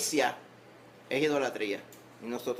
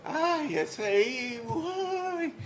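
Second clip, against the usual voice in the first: high, long drawn-out put-on lament; mock moaning; 13 semitones higher, much slower than usual. Preacher imitating a taxpayer complaining about IVU, mock moaning. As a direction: moan it very slowly in a high mock wail.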